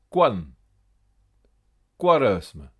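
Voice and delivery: "Quan" and "Quaresme" starting kw. Shown as a demonstration. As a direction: sound a W after K in "Quan", "Quaresme". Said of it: "où l'on prononce que". (« Quan », « Quaresme » avec son kw)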